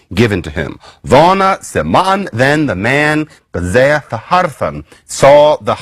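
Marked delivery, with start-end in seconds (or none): 1.0-2.3 put-on reconstructed Old English accent with a Germanic lilt; half-invented Old English accent. Shown as a demonstration. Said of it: "I don't know what accent this is". 3.5-4.8 put-on reconstructed Old English accent with a Germanic lilt; half-invented Old English accent. Shown as a demonstration. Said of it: "I don't know what accent this is".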